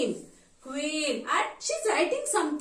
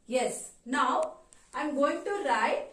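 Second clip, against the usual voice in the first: usual speaking voice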